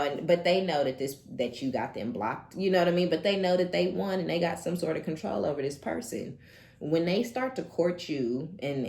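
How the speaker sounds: ordinary talk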